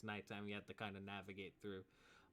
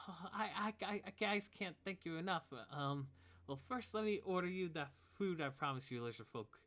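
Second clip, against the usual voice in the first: higher, louder, fast stammering thanks; grateful father; 9 semitones higher, 4 dB louder than usual. Acted. Father thanking the heroes for rescuing him, higher and louder. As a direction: gush thanks, tripping over words. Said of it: "And he says".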